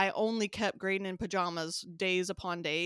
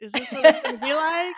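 laughing mock toddler; 6 semitones higher, 11 dB louder, faster than usual. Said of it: "definitely like".

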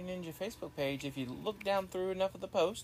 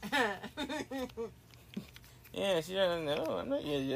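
ordinary talk, nothing marked

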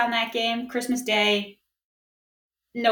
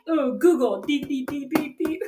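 mock googling; 3 semitones higher than usual. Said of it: "you cannot be like".

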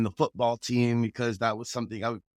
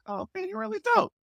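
high squeaky admiring voice; faster than usual. Retold or imitated. Quoting himself praising teacher.

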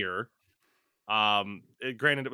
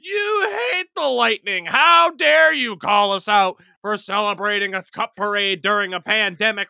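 loud, higher mock outrage at a hater; angry fan; 8 semitones higher, 11 dB louder than usual. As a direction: yell it like a furious hockey fan. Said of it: "where it's like".

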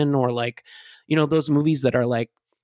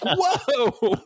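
laughing whoa; 10 semitones higher than usual. Retold or imitated. Mock shocked reaction, laughing.

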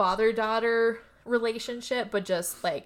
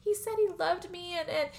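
high, soft, dreamy voice; lightly voiced Rose; 8 semitones higher, 3 dB quieter than usual. Rose telling Mary about her fiancé, high and soft. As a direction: gush it, pitched up and hushed.

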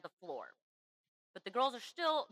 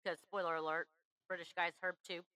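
usual speaking voice

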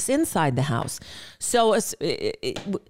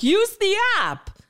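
high, slow mock customer service voice; sing-song mock of a support script; mocking quote; 13 semitones higher, slower than usual. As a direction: parody the company's canned answer. Mocking Cox's scripted reply.

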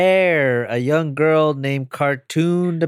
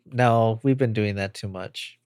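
ordinary delivery

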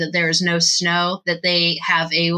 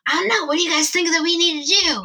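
put-on high young-sounding character voice; Rudolph; 8 semitones higher, much faster than usual. Rudolph asks the others for ideas, high-voiced. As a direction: toss it back in a high voice. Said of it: "And Rudolph goes".

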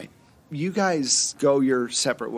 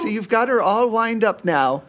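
playful scolding; teasing; 8 semitones higher, 6 dB louder than usual. Acted. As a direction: tease them for getting rowdy. Teasing GM calls order.

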